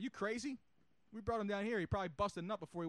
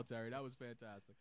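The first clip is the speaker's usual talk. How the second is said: ordinary talk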